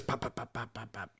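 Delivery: quiet whispered pop pop gunshots; gunfire sounds. Shown as a demonstration. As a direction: mouth gun noises, soft. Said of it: "shoots like this".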